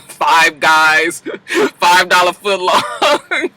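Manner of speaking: joking through laughter, drawn out; slow, laughing delivery. Retold or imitated. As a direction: crack up while drawing out the punchline. Jokey retelling, cracking up.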